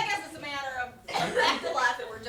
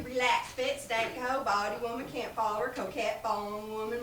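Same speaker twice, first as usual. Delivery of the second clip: normal talking voice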